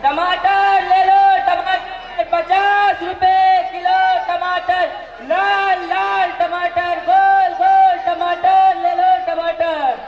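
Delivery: slow, high, loud hawker chant; melodic vegetable vendor call. Acted. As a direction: stretch each word like a market cry. Vendor hawking tomatoes loudly. (सब्ज़ीवाले की लंबी सुरीली पुकार)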